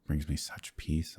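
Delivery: ordinary talk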